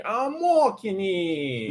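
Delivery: very slow, high, loud New Yorker cry; drawn-out New York shout; New York accent. Retold or imitated. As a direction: drag out the words like a New York cabbie row. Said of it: "New Yorker is like".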